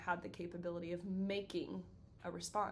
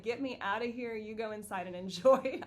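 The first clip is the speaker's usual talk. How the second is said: louder, mock urge to escape; playfully protest, louder; mock disgusted protest; 2 semitones higher, 9 dB louder than usual. Speaker fleeing husband's sauerkraut, mock disgusted protest. Imagined recoil from sauerkraut smell.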